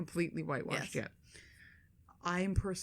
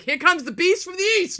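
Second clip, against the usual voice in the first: higher, louder hammed-up ring announcer; mock announcer boast; 10 semitones higher, 11 dB louder than usual. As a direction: bellow it like a wrestler's intro. Suggests a boast for the son.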